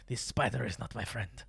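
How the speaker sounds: lightly voiced odd delivery; odd put-on voice. Talking to a spider, a quirky put-on voice. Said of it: "I don't know why I say it like this".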